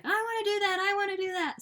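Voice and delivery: put-on high, excited kid begging; pleading like an excited child; whiny kid voice. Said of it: "my kids are totally like".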